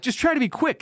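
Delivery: high, lightly voiced nurse; a hint of a hurried nurse. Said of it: "The nurse is like".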